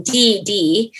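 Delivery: ordinary talk, nothing marked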